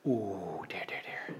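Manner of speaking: breathy whispered dismay, trailing away. Real, not acted. Preacher sadly whispering over his students' attitude. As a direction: sigh it out under your breath, saddened.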